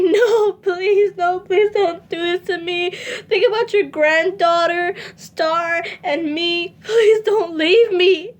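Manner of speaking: high, loud, tearful begging; sobbing plea. Retold or imitated. Begging her mother not to leave her, sobbing. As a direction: plead through sobs not to be left. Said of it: "I screamed and cried".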